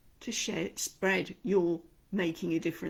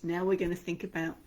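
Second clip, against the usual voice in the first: ordinary delivery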